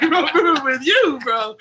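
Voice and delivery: high, loud, laughing mock surprise; laughing disbelief. Recalling his stunned reply to Jay, laughing loudly. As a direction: echo it back half laughing, half stunned.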